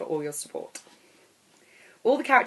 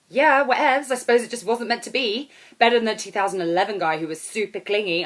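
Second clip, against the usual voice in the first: put-on high, loud, brassy holiday girl; brash loud woman; 4 semitones higher, 7 dB louder than usual. Airport stranger hailing the narrator, high and loud. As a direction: belt it out like a brash party girl.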